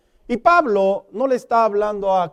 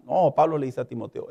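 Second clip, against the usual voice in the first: ordinary talk, nothing marked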